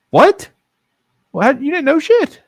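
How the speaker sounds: very high-pitched, rising disbelief; incredulous challenge. Retold or imitated. Brother disputes sister's claim she knew, loudly incredulous. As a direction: squawk it back in mock outrage.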